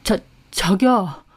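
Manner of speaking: slow, halting stammer; flustered. A narrator's reading. Boyfriend caught at the rival's shop, flustered. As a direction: stumble over it, caught out. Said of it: "몹시 당황한 얼굴이었습니다".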